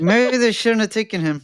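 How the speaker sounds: jaw clenched, teeth closed; talking through teeth. Real, not acted. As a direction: push words through shut teeth. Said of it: "'cause I'm talking through my teeth".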